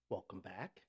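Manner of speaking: usual speaking voice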